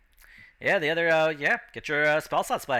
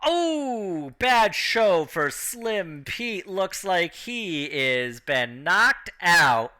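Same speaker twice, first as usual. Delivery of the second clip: drawn-out, higher-pitched announcer call; slow, stretched ringside commentary; ring announcer; 4 semitones higher, much slower than usual. Acted. Announcer telling the crowd Slim Pete is knocked out, drawn-out announcer voice. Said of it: "the announcer's just like".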